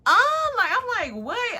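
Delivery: very high, loud exasperated outburst; incredulous high-pitched yelp; annoyed disbelief. Retold or imitated. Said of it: "I'm getting annoyed".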